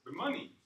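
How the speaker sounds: "money" punched with a rising tune; stress on MONEY. Shown as a demonstration. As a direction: lift "money" sharply as the key word. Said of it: "It depends where you move emphasis".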